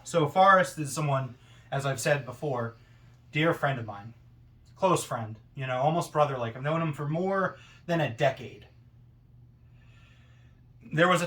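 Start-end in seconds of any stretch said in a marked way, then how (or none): none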